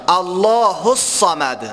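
الله الصمد slow, lam in الله full and thick; slow recited الله الصمد; heavy lam. Shown as a demonstration. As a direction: slowly stress the heavy L of الله.

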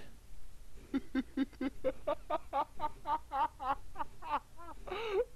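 high, breathy ghostly laugh; creepy whispered laugh. Acted. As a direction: let out a ghost's eerie laugh. Eerie ghost laugh in game.

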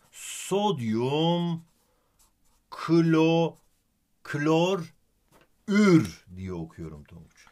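"Sodyum, klor, ür" with a pause before each part; reading the name aloud. Shown as a demonstration. Teacher demonstrates reading sodium chloride aloud for student. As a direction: break it into "Sodyum", "klor", "ür" and lean on the "ür". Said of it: "ben bunu şöyle okuyorum".

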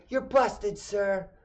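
high, loud, mocking accusation; gloating gotcha. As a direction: relish catching him red-handed. Dad catching him out.

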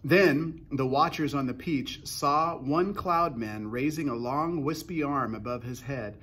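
usual speaking voice

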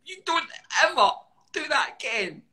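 put-on high, loud child yelling a warning; child's angry outburst. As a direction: play the furious little boy scolding him. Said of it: "He's like".

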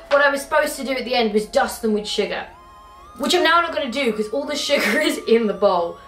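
normal talking voice

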